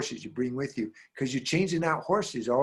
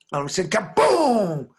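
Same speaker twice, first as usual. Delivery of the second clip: dramatic boom; 6 semitones higher, 6 dB louder than usual. Retold or imitated. Lightning strike in story.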